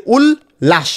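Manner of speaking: উল্লাস slowly, "ul... lash"; piece by piece. Shown as a demonstration. Teacher sums up the spelling, উল্লাস slowly broken in two. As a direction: stretch and split উল্লাস after "ul". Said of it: "টুকরো টুকরো করে উচ্চারণ".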